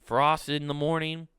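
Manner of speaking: usual speaking voice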